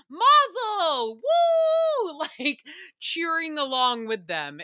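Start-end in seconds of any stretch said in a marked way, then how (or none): 0.1-1.2 cheering scream. Retold or imitated. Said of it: "I literally screamed at the T V". 1.3-2.3 high laugh. Real, not acted. Laughing after cheering.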